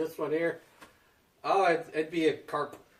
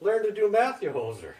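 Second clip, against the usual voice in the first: mocking jab; 7 semitones higher than usual. Mocking brother's math.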